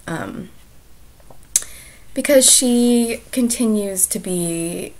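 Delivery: ordinary talk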